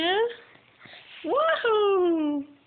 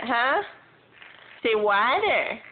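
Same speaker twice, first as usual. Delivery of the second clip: ordinary delivery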